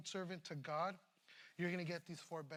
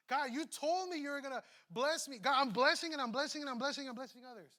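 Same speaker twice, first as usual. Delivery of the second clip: loud, higher, insistent plea to God; hammed-up believer nagging God for his due; mock demanding prayer; 6 semitones higher, 11 dB louder than usual. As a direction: pretend believer loudly holding God to his promise. Voicing a believer demanding blessing.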